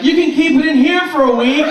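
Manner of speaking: loud, high-pitched mock girl voice; hammed-up girl. Girl answering in an eighties plot, hammed-up high voice. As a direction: belt it out as an over-acted girl. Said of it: "then some girl will be like".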